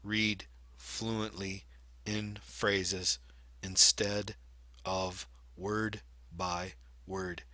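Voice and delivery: slow, halting, one word at a time with pauses; choppy word-by-word reading. Shown as a demonstration. Teacher imitating a poor reader's slow choppy reading. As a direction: read like a struggling reader, stopping after every word. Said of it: "it could sound something like this".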